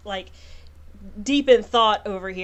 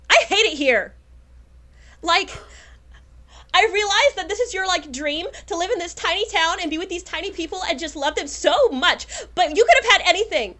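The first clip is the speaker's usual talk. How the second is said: exasperated villain confession; 9 semitones higher than usual. Acted. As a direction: spill the whole scheme with contempt. Villain confesses her scheme.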